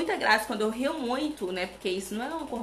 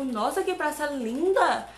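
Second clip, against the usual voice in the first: gushing, drawn out and louder at the end; retold admiration; 4 semitones higher, 5 dB louder, slower than usual. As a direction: re-enact being wowed, pitch climbing. Revive sua admiração pela praça. (admiração encenada)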